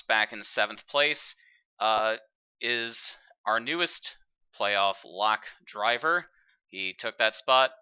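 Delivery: usual speaking voice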